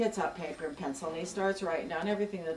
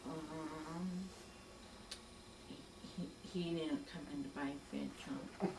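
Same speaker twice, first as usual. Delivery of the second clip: quiet, sheepish mumble that fades to a breath; sheepish, head hung; 4 semitones lower, 12 dB quieter than usual. Retold or imitated. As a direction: confess it softly, ashamed. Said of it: "hung his head".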